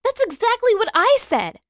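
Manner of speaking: high, loud vindicated exclaim; exclamation. A narrator's reading. Katara agreeing with Zuko, loud vindicated exclaim. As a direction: burst out, finally proven right. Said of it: "Katara exclaimed".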